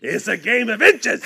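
laughing. Real, not acted. Laughing repeat of punchline.

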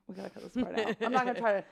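ordinary delivery

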